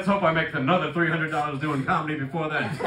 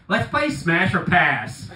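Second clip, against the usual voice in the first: drawn-out, deliberate punchline; slow reveal; 5 dB louder, much slower than usual. Retold or imitated. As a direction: stretch it out for the punch. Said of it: "he just goes".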